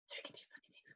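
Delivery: unvoiced whispered aside; soft whispered aside. Real, not acted. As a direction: whisper it under your breath.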